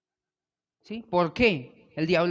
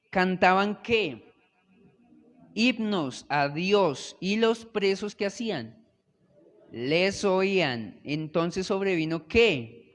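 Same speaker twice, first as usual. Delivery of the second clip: slow call and answer; 4 semitones higher, 3 dB louder, slower than usual. Acted.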